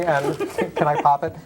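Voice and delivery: normal talking voice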